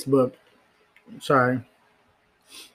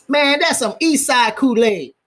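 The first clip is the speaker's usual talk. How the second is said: much higher, louder, in a put-on character voice; 11 semitones higher, 4 dB louder than usual. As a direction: say it like a different person, hyped. Repeats the phrase in a put-on voice.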